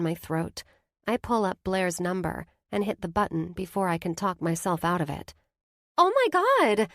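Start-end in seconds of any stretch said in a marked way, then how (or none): 6.0-6.9 high, loud excited squeal; thrilled shriek of a friend. A narrator's reading. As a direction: squeal with delight at the call. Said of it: "she squeals".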